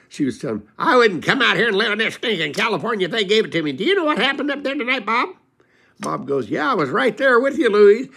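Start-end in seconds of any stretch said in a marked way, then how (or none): 0.8-5.3 high, loud griping wife, rushing at the end; nagging wife. Retold or imitated. Complaining wife voiced in story. 6.5-8.1 high, loud put-upon husband; weary husband. Retold or imitated. Husband answering wife, voiced.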